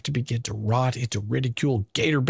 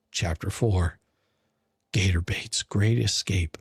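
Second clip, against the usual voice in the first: a secretive stage whisper of the title; 5 semitones lower than usual. Acted. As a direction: breathe it like a secret. Chapter title read dramatically.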